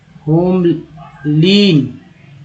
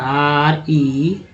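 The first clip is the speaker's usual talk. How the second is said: letters spelled slowly, one by one; slow letter-by-letter spelling; much slower than usual. Shown as a demonstration. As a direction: drag out each letter of remember. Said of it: "एक word ले लेते हैं remember का".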